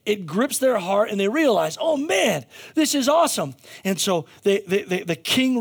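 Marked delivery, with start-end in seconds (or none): 1.8-3.5 excited realization. Acted. Nineveh's people realize God's message.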